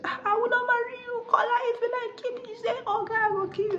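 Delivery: very high-pitched acted quarrel; heated defiance. Bride and groom quarrelling over the wedding, heated defiance.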